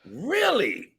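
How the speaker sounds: high, drawn-out "really"; a long, surprised rise in pitch. Real, not acted. Surprised reply to coach's answer.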